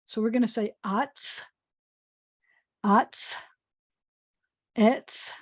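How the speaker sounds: slow, clear letter sounds atz, etz; sounding out letters. Shown as a demonstration. Teacher drilling sounds for children, clear and slow. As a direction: drill each syllable for the class. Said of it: "I give you an aleph to pretend".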